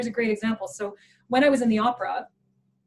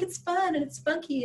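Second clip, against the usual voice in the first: chirpy defense; 6 semitones higher than usual. Retold or imitated. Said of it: "I'm like".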